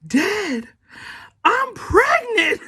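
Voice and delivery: high, drawn-out mock wail; hammed-up crying announcement; breaking down crying. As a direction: imitate a tearful confession to dad. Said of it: "broke down and cried and said".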